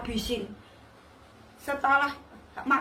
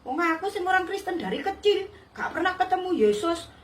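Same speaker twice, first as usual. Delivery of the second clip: higher-pitched indignant scolding; retold angry mother; 6 semitones higher than usual. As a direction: imitate an outraged mother telling someone off. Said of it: "ibunya ngamuk bilang". (menirukan ibu mengamuk, nada tinggi)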